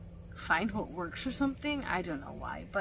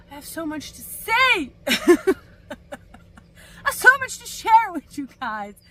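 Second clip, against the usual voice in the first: laughing excitement; 7 semitones higher, 12 dB louder than usual. Real, not acted. Vlogger to her viewers, eager laughing burst. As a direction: laugh through it, much louder and higher.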